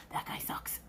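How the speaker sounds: secret whisper; whispering to a woman about her date; whispered warning. Retold or imitated. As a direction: hush it so the date can't hear.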